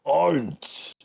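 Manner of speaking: slow, menacing demon lord; Lucifer voice. Acted. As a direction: lean in slowly, menacing. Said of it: "and he goes".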